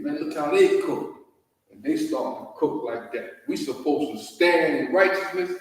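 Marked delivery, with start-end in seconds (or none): 0.0-1.1 mock onlooker voice.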